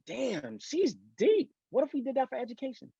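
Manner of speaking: impressed inner reaction. Retold or imitated. Acting out own thought on reading.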